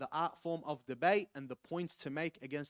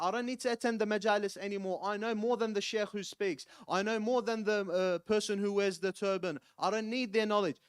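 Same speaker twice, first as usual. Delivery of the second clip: a touch of mocking arrogant youth, loud and higher; 7 semitones higher, 7 dB louder than usual. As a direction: sneer it as the defiant young man. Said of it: "where they say that".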